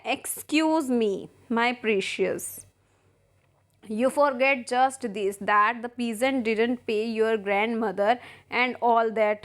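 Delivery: reads the English lines slowly, word by word; slow play reading. Acted. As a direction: spell out each line of the play for the class. Teacher reads play aloud. (धीमा नाट्य पाठ)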